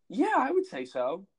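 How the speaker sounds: understanding teacher. Retold or imitated. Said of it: "And she was like".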